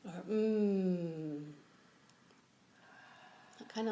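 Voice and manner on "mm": long, loud, falling "mmm"; contented hum. Shown as a demonstration. Like getting into a warm bath, long contented hum. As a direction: hum out the breath with pleasure. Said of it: "you can make any sounds that feel good".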